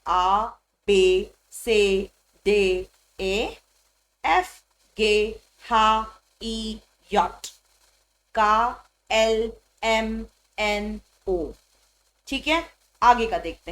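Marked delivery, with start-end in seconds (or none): 0.1-11.5 slow, separate letter names from आ to ओ; German alphabet recital. Shown as a demonstration. Teacher revising the alphabet with learners, each letter from आ slowly separated. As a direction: draw out each German letter, आ, बे, से onward, with gaps. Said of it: "तो अब फटाफट से revise करते हैं".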